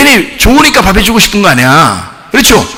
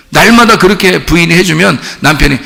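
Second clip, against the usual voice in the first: normal talking voice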